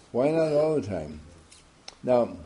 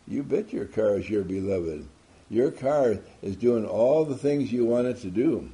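normal talking voice

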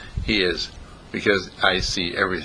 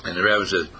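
ordinary delivery

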